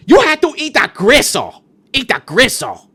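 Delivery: loud imitated command, starting high; mock scream at a diner; yelling waitress. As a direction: bellow it as a pushy waitress giving orders. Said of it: "you gotta get yelled at by".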